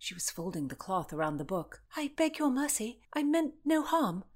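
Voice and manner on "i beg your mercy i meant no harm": high-pitched plea for mercy; imploring. A narrator's reading. Constance to the angry Countess, imploring. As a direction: plead in a raised, anxious voice. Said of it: "Constance implored".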